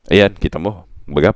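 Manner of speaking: ordinary talk, nothing marked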